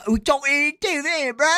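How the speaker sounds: put-on British slang voice, climbing high; mock British accent. Streamer riffing on UK talk for chat, mock British accent. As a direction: imitate a British lad, pitch rising sharply.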